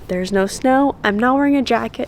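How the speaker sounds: ordinary talk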